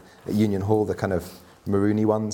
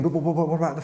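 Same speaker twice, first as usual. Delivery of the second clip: fast, higher flustered stammering; 6 semitones higher, much faster than usual. Retold or imitated. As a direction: sputter like you're caught off guard. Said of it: "you can imagine Martha going".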